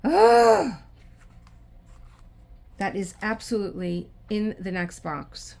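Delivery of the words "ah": frustrated scream. Real, not acted. Sees drill overlapping next box.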